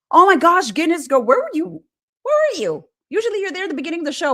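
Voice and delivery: high, loud delighted call; thrilled to see her; excited. Real, not acted. Streamer greeting a late viewer, excited.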